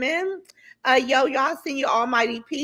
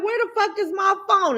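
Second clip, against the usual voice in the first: retold yell; 9 semitones higher than usual. Mother in bed wanting her phone, high mock yell. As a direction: holler it high, as if to another room. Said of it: "I'm yelling for my phone".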